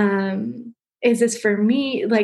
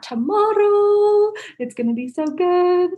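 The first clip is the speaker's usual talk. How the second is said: excited; 9 semitones higher than usual. Real, not acted. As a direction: let the excitement lift and stretch each phrase. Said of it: "and I'm so excited".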